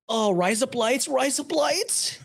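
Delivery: high, slowing Aussie chant; mock Australian. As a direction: draw it out like a hammy Australian. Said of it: "impression of an Australian".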